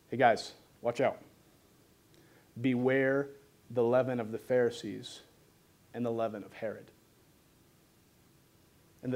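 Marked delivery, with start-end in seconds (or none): 0.1-6.9 a hint of a solemn warning Jesus; cryptic Jesus. Jesus cryptically warns his disciples, low and soft. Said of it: "He says".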